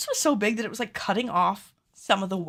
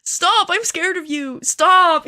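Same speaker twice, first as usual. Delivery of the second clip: higher, louder, hammed-up plea; playful mock-terrified plea to Peter; mock scared; 9 semitones higher, 10 dB louder, slower than usual. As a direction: pretend to be frightened of him. Playfully begging watching Peter to stop.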